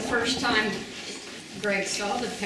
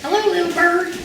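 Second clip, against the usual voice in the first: put-on high, louder small child greeting; little boy's voice; 12 semitones higher, 7 dB louder than usual. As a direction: squeak "Hello" like a four-year-old boy. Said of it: "Greg said to the bird".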